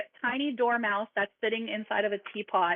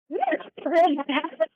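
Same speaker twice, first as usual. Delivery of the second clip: Mad Hatter voice; 7 semitones higher, 4 dB louder than usual. Acted.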